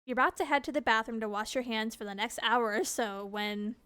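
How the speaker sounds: ordinary talk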